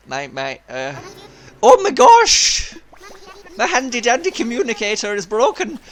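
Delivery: excitable sailor, lurching high and loud in dismay; animated castaway seagull. Acted. Castaway finds his communicator broken, loud theatrical dismay. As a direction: play a melodramatic cartoon sailor bird.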